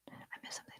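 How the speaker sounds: barely audible unvoiced whisper; whisper to self. Real, not acted. Scanning the viewers' comments for a missed one, tiny whisper. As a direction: repeat it almost silently, just for yourself.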